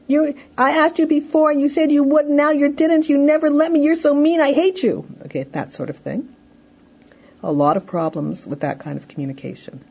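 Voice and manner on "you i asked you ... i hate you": high, loud whining tirade at a parent; loud whining child. Acted. Child verbally attacking mother, loud and whining. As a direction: whine and yell like an outraged kid. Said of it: "getting loud, whining, just being obnoxious".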